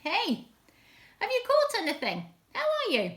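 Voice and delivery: high-pitched storybook shout; calling out. Acted. Man on the beach hailing fishermen in their boat, shouting. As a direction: call across the water to the boat. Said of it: "and he shouted".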